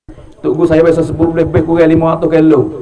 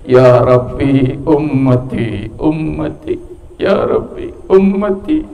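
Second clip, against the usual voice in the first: slow, stretched-out imploring; drawn-out plea; 6 semitones lower, slower than usual. Retold or imitated. Prophet pleading with God for his people, drawn-out imploring. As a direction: plead it slowly, lingering on each word.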